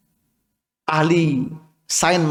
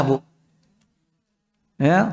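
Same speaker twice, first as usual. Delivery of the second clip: ordinary delivery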